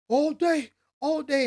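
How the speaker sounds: high, loud sideline boast; hype shout. Retold or imitated. Star receiver yelling to the sideline, loud boast. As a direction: bellow it, pumped and cocky. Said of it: "he can go to the sideline and be like".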